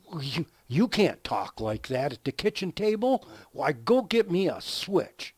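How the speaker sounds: a shocked mom laying down the law; scolding mother. Retold or imitated.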